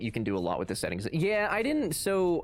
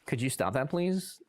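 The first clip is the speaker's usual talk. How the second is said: normal talking voice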